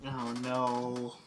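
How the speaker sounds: low, soft groaned reaction; real sinking dismay, kept low; quiet dread. Real, not acted. Player hears a spell is coming, quietly dismayed. Dread at being asked to save.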